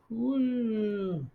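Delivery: high whooshing rocket launch cry; rocket noise. Pretend. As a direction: blast off with a high woo. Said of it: "make rocket noises".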